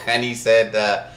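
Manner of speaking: ordinary delivery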